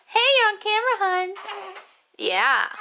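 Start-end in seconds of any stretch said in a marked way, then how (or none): 0.1-1.3 high, louder cooing. Real, not acted. Mother telling her baby he is on camera.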